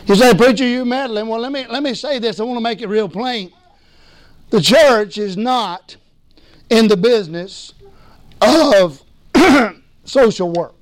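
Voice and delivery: ordinary talk, nothing marked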